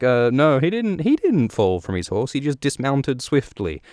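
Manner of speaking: mildly acted high-pitched denial; defensive excuse. Dany excuses Drogo's fall to the blood riders, mock defensive.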